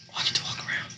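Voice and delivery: soft unvoiced whisper. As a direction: keep it a hushed whisper. Repeat of the whispered line.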